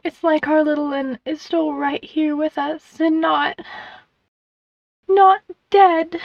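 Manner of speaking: high, tearful mother's voice; grieving mother, pitched high; sniffling grief. A narrator's reading. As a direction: choke up as you speak, breaking off before the hardest words. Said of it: "Her mama sniffed".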